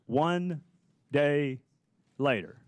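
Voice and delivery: "one... day... later", a pause after each word; word-by-word emphasis. Real, not acted. Questioner drives the timing home to the inspector general, "one... day... later" word by word. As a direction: set "one", "day", "later" apart for weight. Repeats the date gap for emphasis.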